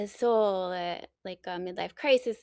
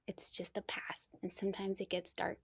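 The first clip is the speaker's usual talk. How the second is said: hushed, gentle whisper; whispering while hiding; 10 dB quieter than usual. Real, not acted. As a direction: whisper it softly. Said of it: "I'm hiding".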